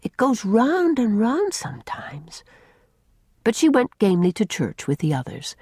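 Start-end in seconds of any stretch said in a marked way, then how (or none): 0.0-2.4 put-on high, slow, airy child voice; weak child, drifting dreamily; dizzy little girl. A narrator's reading. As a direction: let it swim slowly, light and woozy. Faint hungry girl describes dizziness.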